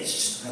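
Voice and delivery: soft hissed spray burst; quiet voiceless "shh" of spray; spray noise. Shown as a demonstration. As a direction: imitate a spray bottle misting. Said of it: "tembak spray".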